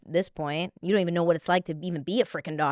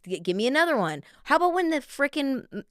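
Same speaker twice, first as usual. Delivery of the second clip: ordinary talk, nothing marked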